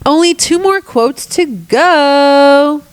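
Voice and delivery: sing-song it like good news; cheerful slow announcement; upbeat announcement. Real, not acted. Announcing progress.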